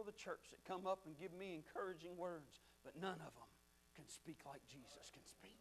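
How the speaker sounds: very soft and low, fading to a whisper; hushed awe. Real, not acted. Preacher on how Jesus alone speaks, hushed awe. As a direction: let it sink away to a whisper.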